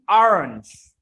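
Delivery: "orange" starting with ah, AH-runj; open-vowel orange. Shown as a demonstration. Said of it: "Nggak ada orange".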